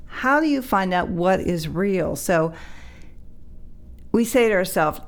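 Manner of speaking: usual speaking voice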